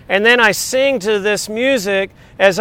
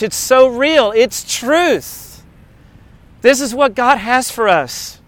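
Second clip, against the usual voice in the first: usual speaking voice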